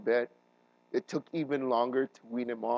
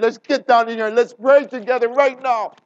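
loud, higher rallying shout; urgent call; 11 semitones higher, 15 dB louder than usual. Retold or imitated. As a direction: call everyone to pray now. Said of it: "grabbed everyone on me and said".

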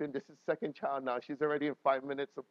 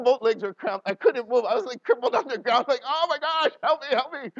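high, loud panicked pleading; hammed-up agony; 9 semitones higher, 8 dB louder than usual. Pastor reenacting leg cramps on the walk back, hammed-up agony. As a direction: cry for help, hamming up the pain.

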